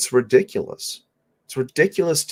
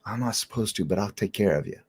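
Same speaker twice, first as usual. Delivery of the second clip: softer, hurried conspiratorial hush; mock secretive nurse, quiet; 6 dB quieter, faster than usual. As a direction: nurse hushing a patient, softer. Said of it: "She said".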